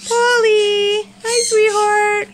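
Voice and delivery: high, loud, slow delighted hello; excited pet greeting. Real, not acted. Owner greeting her parrot Polly, loud high delight. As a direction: sing out to your bird.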